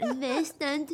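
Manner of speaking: giggly Scottish "Invested"; Scottish accent. Shown as a demonstration. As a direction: show off your Scottish accent through laughter. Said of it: "That's my Scottish accent".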